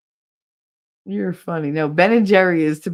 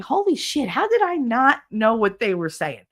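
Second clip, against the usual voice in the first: higher-pitched amazed reaction; retold astonishment; 6 semitones higher than usual. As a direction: act out belated disbelief. Reacting to cartoons watched now.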